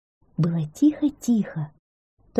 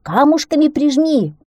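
high, loud urgent command; excitable hare's high yell; squealing; 10 semitones higher, 6 dB louder than usual. A narrator's reading. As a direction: squeal an order to hurry. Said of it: "верещал заяц".

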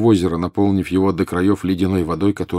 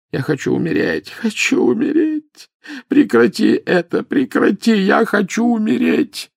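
tormented howl. A narrator's reading. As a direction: howl it in agony. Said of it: "взвыл с ещё большей, чем прежде, мукой". (мучительный вой)

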